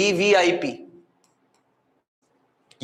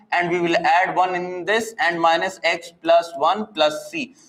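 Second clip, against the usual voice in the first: usual speaking voice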